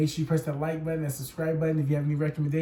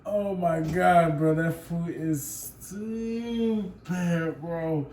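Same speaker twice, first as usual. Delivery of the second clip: drawn-out, slow disbelief; slow disgust; much slower than usual. Real, not acted. Friend reacting to Havarti cheese, slow disbelief. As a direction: drag it out in disgust.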